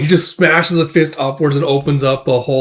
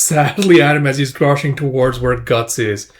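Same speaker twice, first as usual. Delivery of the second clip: plain reading voice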